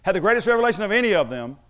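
loud, emphatic sermon delivery; preaching. Real, not acted. Preaches at full force.

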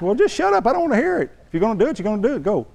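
high-pitched, impatient imitation of a wife; exasperated wife. Wife telling sulking husband to just go, exasperated. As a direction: imitate a wife cutting off the griping. Retold wife fed up with him.

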